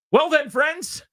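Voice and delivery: bow out like a stage actor; playfully pompous exit line; mock grand farewell. Mock grand exit from awkward table.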